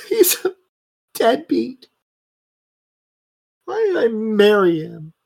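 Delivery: high, tearful complaint about her husband; distraught wife. Acted. Battered wife pouring out her troubles to a stranger, tearful. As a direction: wail it out like a wronged wife. GM voices crying drunk's wife.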